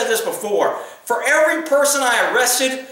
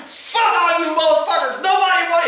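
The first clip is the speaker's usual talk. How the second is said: higher, a mock angry yell cut off; retold angry yelling; 6 semitones higher than usual. Furious man cursing at the cop, mock angry yell. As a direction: bark it like the furious guy, cut off mid-word. Enraged man throwing chairs.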